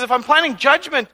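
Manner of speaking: solemn voice of God, loud then settling; quoting God. Retold or imitated. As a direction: announce it as God laying down terms. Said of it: "He says".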